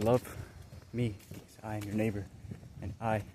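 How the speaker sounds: low, soft fatherly address; quiet, solemn voice from within; voice of the Spirit. Retold or imitated. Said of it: "it came out of me".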